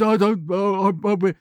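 a mock stammer from a nervous reader; stuttering. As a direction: stumble over the words as if lost.

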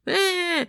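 high, drawn fake bawl; demonstrative mock cry. Dad showing his kids how to cry, high mock wail. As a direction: show them how to cry, obviously faked. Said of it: "look, I'ma cry".

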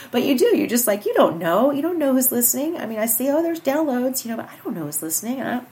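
ordinary delivery

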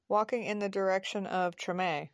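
ordinary delivery, nothing marked

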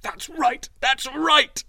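high, loud exclamation, building; excited cry. A narrator's reading. Young man realizes who freed him, excited cry. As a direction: cry out in sudden recognition. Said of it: "he cried".

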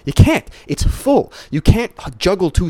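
high, loud burst on "You can't"; excited insistence, pitch shooting up; emphatic. Real, not acted. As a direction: jump way up to drive the point home. Emphatic ranting point.

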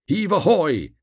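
high, loud hauling call; sailors' shout. A narrator's reading. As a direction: call out like sailors heaving together. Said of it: "they shouted".

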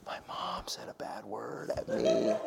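unvoiced, hushed inner voice; whispered thought. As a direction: breathe it like a shocked child.

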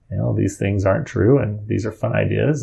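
ordinary talk, nothing marked

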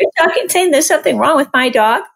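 high, mock-offended squeal; dog owner mocking her own huffy reaction; indignant ego voice. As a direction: play your own bruised ego, way up high. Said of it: "It's totally like".

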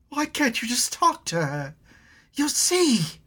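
high tearful pleading; crying girl. Acted. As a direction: plead through tears. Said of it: "Elna started crying".

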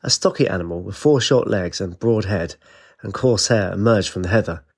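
ordinary delivery, nothing marked

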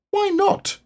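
put-on very high, small child voice; a baby kangaroo piping up; little Roo. A narrator's reading. Narrator voices Roo to the listeners, very high and small. Roo's squeaky voice.

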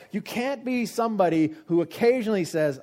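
emphatic preacher's rebuke; forceful admonishing. Real, not acted. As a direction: admonish the crowd at full volume.

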